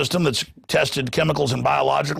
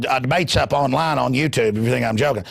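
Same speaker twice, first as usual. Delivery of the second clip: ordinary talk, nothing marked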